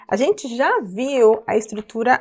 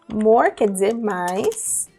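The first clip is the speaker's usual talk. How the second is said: slow teaching; slower than usual. Shown as a demonstration. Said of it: "teacher drawing out the word".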